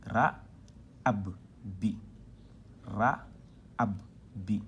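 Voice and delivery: "Ra, ab, bi" recited syllable by syllable; doubled-letter demonstration. Shown as a demonstration. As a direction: sound out "ab" then "bi", clearly separated. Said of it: "quand on dédouble". (syllabes détachées, lettre dédoublée)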